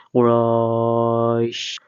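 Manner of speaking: কুরাইশ drawn out slowly with a clear final শ; কুরাইশ টেনে ধীরে তেলাওয়াত; slow drawn recitation. Shown as a demonstration. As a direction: chant কুরাইশ long and slow, ending on the শ sound. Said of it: "আবার র মিলবে সিনের সাথে".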